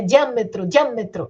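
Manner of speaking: "diâmetro" with di-â run into one syllable; "diâmetro" glided, no break after the d; run-together pronunciation. Shown as a demonstration. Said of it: "Como é que você pronuncia isso aí".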